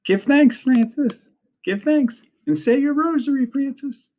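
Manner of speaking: very high sing-out mimicry of a nun; Patricia's mocking whisper. A narrator's reading. Girl mimicking the nun to make the boy laugh, mock-whispering high. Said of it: "Then Patricia whispers".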